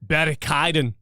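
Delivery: loud Scottish governor introducing himself. Acted. Governor greeting the lawyer, in a loud Scottish accent. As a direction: boom out the Scottish governor's name.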